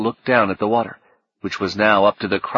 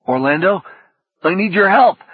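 high, louder plea for help; desperate call; 9 semitones higher, 5 dB louder than usual. A narrator's reading. Fredericks calling to Orlando as he weakens, desperate.

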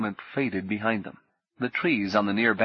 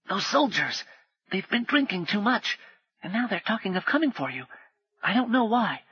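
put-on much higher boy's voice, hushed and quick; urgent potboy; 11 semitones higher than usual. Potboy warning Paul of soldiers, high and hushed. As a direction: warn him like a frightened street boy.

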